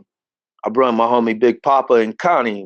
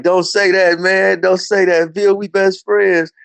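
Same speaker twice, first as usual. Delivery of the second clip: pleading friend; 7 semitones higher than usual. Retold or imitated.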